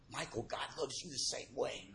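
an inner voice telling Michael he is loved, softly; hushed inner voice. Retold or imitated. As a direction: let it arrive like a quiet message. Inner voice speaks to him.

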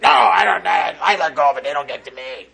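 deep gravelly ex-Navy chief voice; loud gravelly insistence, then trailing off; gruff tough guy. Retold or imitated. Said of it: "Deep, gravelly voice".

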